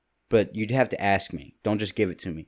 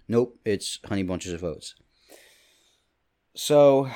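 ordinary delivery

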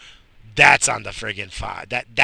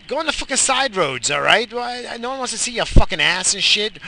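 much higher, loud furious yell; angry yell; 10 semitones higher, 5 dB louder than usual. Real, not acted. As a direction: bark it at the cyclist. Yelling at cyclist in traffic.

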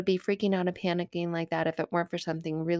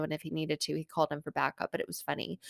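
ordinary talk, nothing marked

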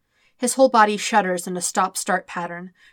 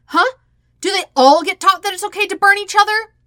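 loud, much higher accusing tirade; defensive, volatile; 11 semitones higher, 7 dB louder than usual. Acted. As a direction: lash out while defending yourself. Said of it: "defensive but volatile".

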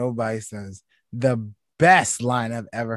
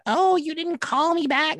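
whiny mock quote; slower than usual. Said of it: "about how".

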